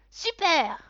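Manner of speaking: put-on high, loud kid's cheer; boy cheering. A narrator's reading. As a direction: cheer like a thrilled child. (enfant ravi, cri aigu)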